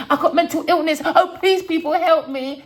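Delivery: high, loud hammed-up pleading with a laugh; mocking whiny plea. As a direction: ham up a fake cry for help. Mocks her plea for sympathy.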